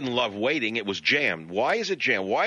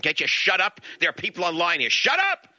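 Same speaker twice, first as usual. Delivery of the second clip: exasperated outburst; 7 semitones higher than usual. Acted. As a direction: tell them off, building to a yell. Imagined telling off cell phone users.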